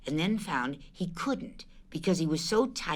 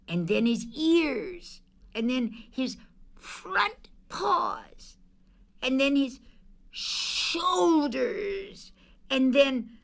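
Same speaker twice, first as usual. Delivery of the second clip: slow building suspense; 9 semitones higher, much slower than usual. A narrator's reading. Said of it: "slow building suspense narration".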